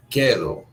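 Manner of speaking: "quedo" with a hard k, no w sound; Spanish pronunciation. Shown as a demonstration. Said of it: "It is not quedo".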